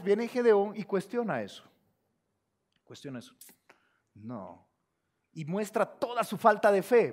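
ordinary delivery

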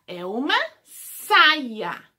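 stretched out slowly, word by word; slow teacher word. Shown as a demonstration. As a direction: say it slowly for small kids. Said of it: "teacher names picture word".

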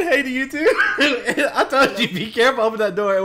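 high, loud, through laughter; laughing scolding. Real, not acted. Teammate teasing whoever opened the door, laughing scolding. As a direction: scold a friend while cracking up.

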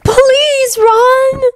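high-pitched pleading character voice; pleading girl. A narrator's reading. Luna voiced, pleading with Ron.

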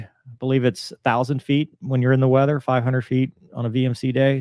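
normal talking voice

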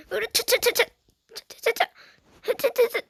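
high straining heave chant; effort grunt. Real, not acted. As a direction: strain hard, grunting rhythmically. Straining climb in the game. (힘쓰는 기합 소리)

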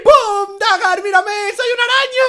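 high, loud, thrilled announcement; excited reveal. Acted. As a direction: show off the transformation with glee. Celebra su transformación en araña. (anuncio agudo y entusiasmado)

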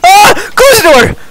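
high terrified yell; panicked scream. Real, not acted. Panicked at alien in game.

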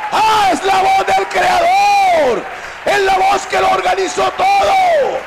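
stretched, climactic pulpit shout; full-force preaching. Acted. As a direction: thunder it out to the congregation.

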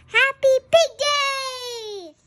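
high, loud and slow, cheering the big news; joyful announcement of the holiday; excited cheer. Acted. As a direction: shout it out like the best news ever. Piggie cheering the big day.

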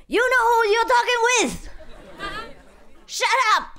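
high, laughing mock yell from the general; mock general's shout. General yelling down the phone, high laughing mock shout. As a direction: play the furious general, half laughing. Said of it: "shout on top of his voice".

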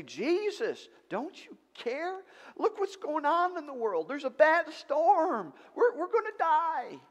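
high, loud, frantic cry for help; panicked plea. Acted. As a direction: beg like you're drowning. Disciples panicking in the storm.

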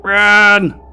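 loud, high alarmed yells; panicked shouting. Real, not acted. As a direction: scream at them to flee. Enemies wake up suddenly.

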